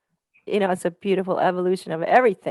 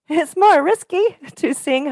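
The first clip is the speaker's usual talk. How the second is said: laughing; 9 semitones higher, 7 dB louder than usual. Real, not acted.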